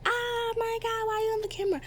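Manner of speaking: mock the teacher freaking out at the camera; freaked-out teacher. Student imitating teacher freaking out at camera, high squeal. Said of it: "she freaked out".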